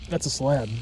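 normal talking voice

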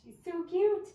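high, loud squeal of delight. Real, not acted. Vlogger gushing over the reborn doll, excited squeal. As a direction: squeal it out over something adorable.

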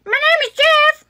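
high-pitched put-on meme voice; old meme quote. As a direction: blurt it out high like a catchphrase. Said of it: "internet sayings from back in her day".

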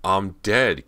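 pained, forceful reply; heartbreaking. A narrator's reading. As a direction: declare it with anguish. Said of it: "came Callie's heartbreaking reply".

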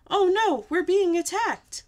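high, loud storybook alarm; alarmed shout. A narrator's reading. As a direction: shout a warning in mock panic. Guard raises the alarm.

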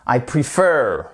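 prefer en « pri-FEUR »; bonne prononciation. Shown as a demonstration. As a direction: appuie sur la seconde syllabe de prefer. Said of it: "on enregistre bien la bonne prononciation". (prefer, fin accentuée et allongée)

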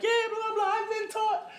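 high, sing-song mocking voice with laughter; sing-song mimicry of a classmate, laughing through it; mocking girl's voice. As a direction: parody her as a squeaky taunting girl. Mocking racist classmate's retort.

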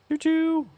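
high choo-choo train call; train whistle. Pretend. Calling choo-choo at the convoy. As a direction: toot it like a toy train.

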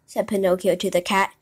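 plain reading voice

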